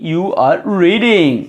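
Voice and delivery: "You are reading" stretched out slowly; teacher gives students the translation, very slow; slow dictation. Shown as a demonstration. Spell the sentence out slowly. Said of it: "पढ़ना का क्या होगा भाई".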